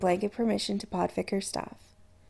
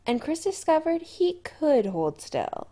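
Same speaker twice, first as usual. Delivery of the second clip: plain reading voice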